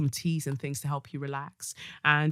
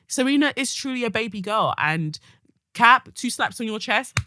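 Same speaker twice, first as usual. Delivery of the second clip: ordinary talk, nothing marked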